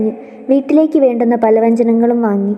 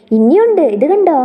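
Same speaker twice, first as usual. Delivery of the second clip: much higher, sing-out eagerness; excited child; 6 semitones higher than usual. Acted. Little girl pointing to more fancy items, excited. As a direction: show off the next treat, pitch swooping up.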